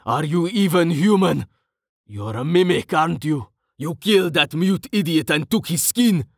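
high, mounting accusation; heated, suspicious confrontation; accusing. A narrator's reading. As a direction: press the charge, voice pitched high. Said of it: "he said accusingly".

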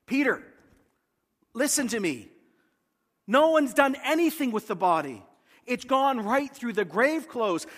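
John pressing Peter to understand; eager urging. Retold or imitated. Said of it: "Can't you hear him talking to Peter?".

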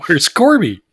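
high, loud mock question; Spock asking. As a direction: call out the question, punching the name. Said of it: "Spock shows up and he's like".

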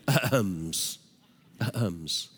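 soft, low throat-clearing word; little ahems. Retold or imitated. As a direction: say it as a discreet cough. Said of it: "his little ahems".

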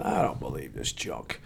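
very low, barely voiced grumble; muttered inner thought. Sceptic in the pew to himself, muttered disbelief. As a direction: mutter it under your breath. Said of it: "and in my mind, I'm saying".

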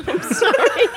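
giggling apology through laughter; laughing. Real, not acted. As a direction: say sorry while cracking up. Apologizing after dropping grapes.

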